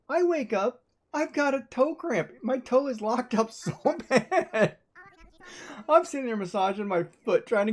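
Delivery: high, laughing pitch with the story's punchline; half-laughing, wound-up retelling; excited disbelief. Real, not acted. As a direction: tell it like you still can't believe it. Retelling real toe cramp.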